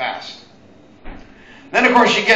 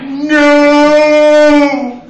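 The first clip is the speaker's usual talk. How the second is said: high, loud, drawn-out sung no; a hammed-up action-movie dive cry; a loud, long movie-hero no as he dives; slow-motion movie no; 8 semitones higher, 9 dB louder than usual. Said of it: "do a Bruce Willis dive".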